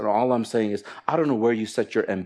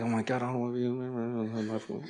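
much softer, dropped grumble; grumbling inner voice; 10 dB quieter than usual. Acted. Preacher voicing a bored life group member, muttered grumble. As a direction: mutter the reluctant thought under the breath. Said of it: "then you sit there and like".

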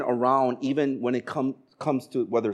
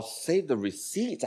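softer, questioning rise on "receipts"; puzzled echo; 8 dB quieter, slower than usual. Retold or imitated. Husband baffled by wife's request, puzzled echo. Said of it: "I don't even know what she's talking about".

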